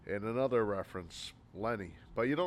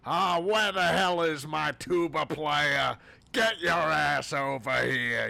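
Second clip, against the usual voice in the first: loud, higher, slow New York bark; angry New Yorker; 6 semitones higher, 8 dB louder, slower than usual. Retold or imitated. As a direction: yell like an impatient film director. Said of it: "acting like an angry New Yorker".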